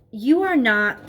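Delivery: ordinary delivery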